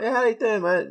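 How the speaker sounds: high, bouncy fake-happy hello; breezy cheery greeting. Comic to friends, masking sadness with a bright high hello. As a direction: put on the everything's-fine cheerful front. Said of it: "like, smiling and, like, bouncing around".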